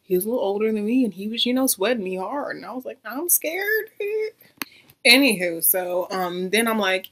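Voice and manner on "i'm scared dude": high-pitched mock-scared squeak. Acted quote of feeling scared.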